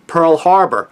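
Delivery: ordinary talk, nothing marked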